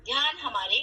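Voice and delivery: measured contemplative delivery with long pauses; guided meditation. Acted. Teacher guiding the class to reflect on who they are, meditative. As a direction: pose each thought, then leave silence to reflect.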